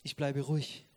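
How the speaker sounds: soft, calm firm voice. Retold or imitated. A family member refusing to shout, calm and soft. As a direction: set the boundary quietly and calmly. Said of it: "der sagt".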